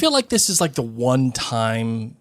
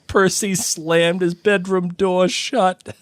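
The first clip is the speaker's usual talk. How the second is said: normal talking voice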